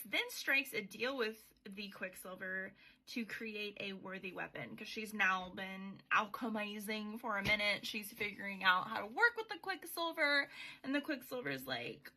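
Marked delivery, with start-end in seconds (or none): none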